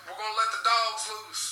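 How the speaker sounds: slow mock warning call; retold police warning. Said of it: "I hear a cop say".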